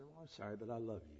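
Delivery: tender reply. Retold or imitated. Teacher answers the raging student, soft and tender. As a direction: soothe him quietly with love.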